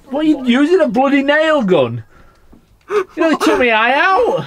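loud, high wail of complaint; outraged protest. Real, not acted. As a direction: cry out indignantly. Complains of injury from nail gun.

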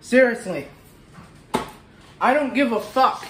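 normal talking voice

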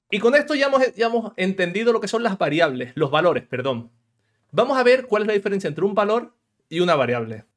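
usual speaking voice

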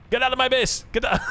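high, German-sounding fake Swedish accent, breaking into laughter; attempted Swedish accent. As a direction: do a bad Swedish accent and crack up. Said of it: "probably just gonna sound like a German accent".

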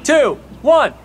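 loud, high countdown to the jump; excited countdown. Real, not acted. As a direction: count down, building hype. Countdown before jumping in pool.

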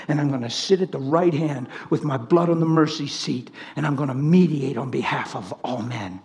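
low, soft, measured declaration; hushed Jesus quote. Acted. Risen Jesus telling disciples he keeps a body, hushed quote. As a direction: drop low and soft, quietly resolute.

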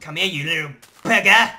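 calling the animal loudly. Acted. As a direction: shout come here, little bugger. Chasing a pig in game.

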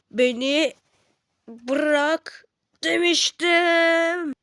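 slow, high, loud angry warning. Acted. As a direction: spell out each word, furious. Angry at character holding him. (yavaş, yüksek sesle öfkeyle)

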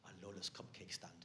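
hushed, secretive whisper; whispered craving. Acted. Secretive craving, queue at cupcake stand.

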